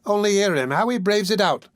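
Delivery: high, mock outraged woman; Nancy turns the crowd against Oliver, mock outraged; indignant cry. A narrator's reading. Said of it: "cried the young woman".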